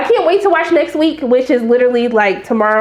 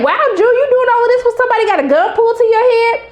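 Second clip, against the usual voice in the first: high-pitched, incredulous reaction; mock amazement; 10 semitones higher than usual. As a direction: marvel at her nerve in a high voice. Mock amazement at June's boldness.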